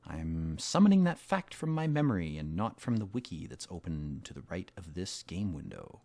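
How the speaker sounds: usual speaking voice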